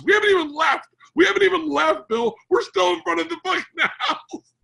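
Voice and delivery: very high, loud, breaking into laughter; laughing shout. Real, not acted. Storyteller losing it over Gary's endless rant, laughing shout. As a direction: shout it through real laughter. Cracking up at his own story.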